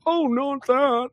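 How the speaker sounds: very high, very slow, exaggerated fake fright; sarcastic mock horror. Mocking fear of being called names, high and slow. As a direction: ham up pretend terror at a trivial threat. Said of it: "I'm like".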